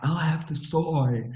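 mock-soothing affected politeness; fake spiritual voice. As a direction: order as a precious guru, drawn out. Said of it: "in that voice".

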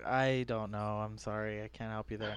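low, very soft mumbled apology; sheepish, fading reply; helpless brush-off. Retold or imitated. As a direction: back away quietly from the question. Acting helpless reply to kid.

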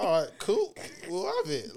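mock casual acceptance. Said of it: "We just like".

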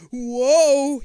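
high, loud startled whoa; startled cry. A narrator's reading. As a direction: cry out losing balance. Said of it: "you cry".

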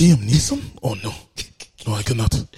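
badly faked African accent, mostly low-pitched; mock African accent, refusing in alarm; awful African accent. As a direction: put on a hammy African pirate's accent. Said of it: "That's an awful accent".

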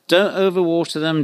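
ordinary talk, nothing marked

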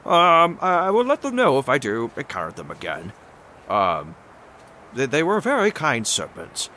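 high-voiced, halting adventurer; Odysseus, politely curious. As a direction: play a high, tentative, courteous hero. Plays Odysseus in the game.

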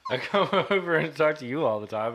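high, giggling storytelling; cracking up mid-sentence; laughing while talking. Real, not acted. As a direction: tell it through bursts of laughter. Laughing while telling on his friend.

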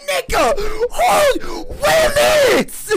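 very high, stretched-out disbelief; mock bafflement. Retelling a reaction to the hungry bear line, baffled. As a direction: squeal the question slowly in comic confusion.

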